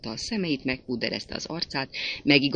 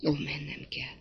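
hushed reluctance; 8 dB quieter than usual. A narrator's reading. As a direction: say it quietly, half to oneself. Reluctant first word of leaving. (halk, vonakodó búcsú)

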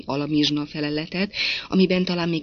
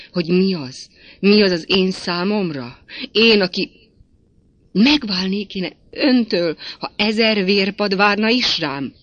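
loud, raised declaration of love; impassioned protest; 4 semitones higher, 8 dB louder than usual. A narrator's reading. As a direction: protest with wounded passion, building. Wounded lover protests his devotion. (hangos, szenvedélyes tiltakozás)

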